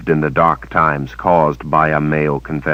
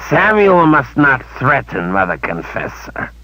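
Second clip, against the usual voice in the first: creature reciting; 8 semitones higher than usual. A narrator's reading. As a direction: recite the rules like a pet.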